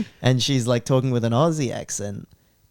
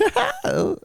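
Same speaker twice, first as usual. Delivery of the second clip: high cartoon villainess cackle; put-on cackle. Harley laughing with the Joker, high mock cackle. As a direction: cackle in a squeaky character voice.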